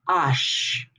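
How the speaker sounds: "Ash" said "ahsh"; French H with open "ah"; French letter H. Shown as a demonstration. Teacher showing French H to class, said "ahsh". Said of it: "this is gonna be".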